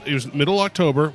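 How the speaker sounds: usual speaking voice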